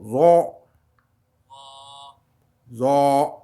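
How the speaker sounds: heavy throaty "Zo"; "Zo", un Z du fond de la gorge; emphatic Z from the throat. Shown as a demonstration. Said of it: "comme un Z du fond de la gorge".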